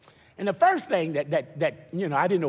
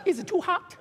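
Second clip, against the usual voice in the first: pedicure lady; 11 semitones higher than usual. Retold or imitated. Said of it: "she go".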